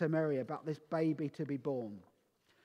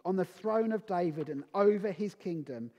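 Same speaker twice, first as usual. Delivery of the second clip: louder, proclaiming Bible reading; scripture reading; 4 dB louder than usual. Acted. Preacher reading Isaiah to the congregation, proclaiming. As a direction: declaim the prophecy to the church. Reads Isaiah nine aloud.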